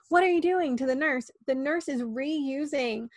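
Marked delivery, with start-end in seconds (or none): 0.1-0.8 high, louder sharp scolding; mock sharp demand. Head nurse catches the nurse's mistake, sharp high scolding. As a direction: snap at the other nurse in alarm. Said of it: "she immediately goes".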